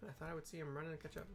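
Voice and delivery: ordinary talk, nothing marked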